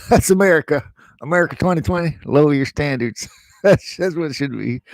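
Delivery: amused outburst, breaking into laughs; laughing. Real, not acted. Joking about America lowering standards, laughing. Laughing at his own joke.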